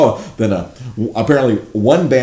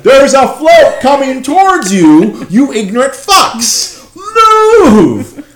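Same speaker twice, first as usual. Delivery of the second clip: high, loud, slow shouting with a laugh; exasperated yell; 8 dB louder, much slower than usual. Retold or imitated. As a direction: yell at the crowd in disbelief.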